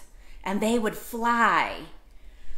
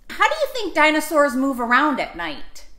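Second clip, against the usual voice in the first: plain reading voice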